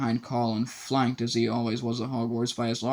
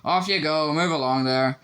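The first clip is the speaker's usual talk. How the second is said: calling out; 4 semitones higher, 4 dB louder than usual. A narrator's reading. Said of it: "Lockhart called to the crowd".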